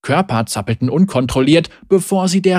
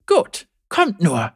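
cocky taunt; 3 semitones higher, slower than usual. Acted. Zeri taunts the guards.

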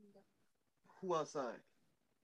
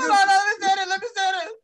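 higher, louder, insistent cut-in; eager interruption; 4 semitones higher, 4 dB louder than usual. Real, not acted. Friend grabbing the story from another, eager interruption. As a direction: jump in over the other storyteller. Cutting in to take over story.

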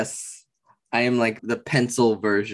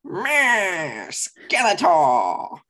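much higher, slow cackling cartoon villain; Skeletor voice; 7 semitones higher, 3 dB louder, much slower than usual. Second try at a Skeletor impression, slow villain cackle. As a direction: start with a meh, then stretch it out like Skeletor. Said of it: "the only way I can do Skeletor".

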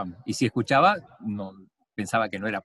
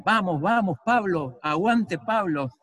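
higher-pitched imitation of trackside cheering; mock-cheer a runner on without shouting; retold cheering; 7 semitones higher, slower than usual. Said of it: "te alentaban y decían".

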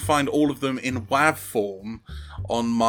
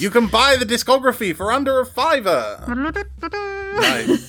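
excited pitch; 12 semitones higher, 4 dB louder than usual. Real, not acted. Excited pitch of cheap discography.

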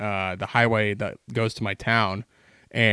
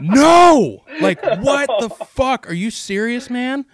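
much higher and louder, outraged cry of disbelief; frustrated yell; 12 semitones higher, 8 dB louder, slower than usual. Retold or imitated. Watching an eagle steal the duck, outraged yell.

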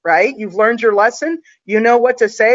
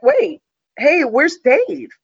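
mock surprise; 6 semitones higher, much slower than usual. Feigning surprise to fool new guard.

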